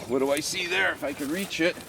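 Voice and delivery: ordinary talk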